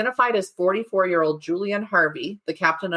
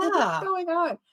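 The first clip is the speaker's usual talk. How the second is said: high, slow, baffled question; high mock bewilderment; 11 semitones higher, slower than usual. Joking about a neighbor's search, high slow baffled voice. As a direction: pitch it way up and stretch it out.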